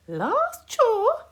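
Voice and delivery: high bright smiling voice; granny smiling, relieved. A narrator's reading. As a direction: say it with a smile. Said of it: "smiled Granny Island".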